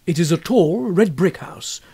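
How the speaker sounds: ordinary delivery, nothing marked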